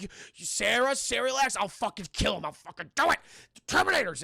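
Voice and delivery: agitated, uneven bursts of shouting; imitated frantic threats; acted standoff yelling. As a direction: play a heated hostage standoff. Acting hostage standoff in film.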